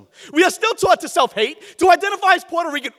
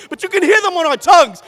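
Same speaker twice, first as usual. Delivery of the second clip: the first take, louder, ringing out; proclaimed; 4 semitones higher, 7 dB louder than usual. Acted. Poet to the slam crowd, a loud first proclamation. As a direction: declare it to the whole room. Poet repeats line for emphasis.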